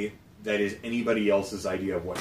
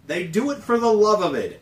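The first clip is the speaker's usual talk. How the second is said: high-pitched earnest; 11 semitones higher than usual. Real, not acted.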